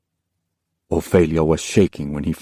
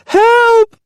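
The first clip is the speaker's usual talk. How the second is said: even higher, louder scream; girl screaming for help, more desperate; louder desperate cry; 8 dB louder than usual. Acted. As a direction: scream with all you have. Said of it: "she cried".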